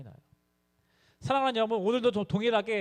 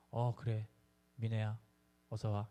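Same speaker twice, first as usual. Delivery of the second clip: put-on confused old father; 8 semitones lower, 9 dB quieter than usual. Son imitating his confused father, low and faint. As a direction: lower and much softer, a dazed old man.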